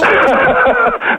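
real, very high peals of laughter. Real, not acted. Woman realising the radio prank, laughing in relief.

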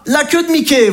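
ordinary delivery

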